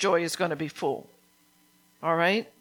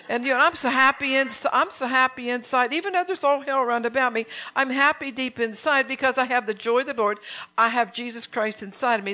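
fervent preaching; 4 semitones higher than usual. Acted. Telling the church how happy she is inside, fervent preaching. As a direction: preach the joy out with full conviction. Preaches about inner joy.

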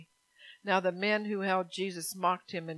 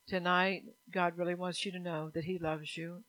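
ordinary talk, nothing marked

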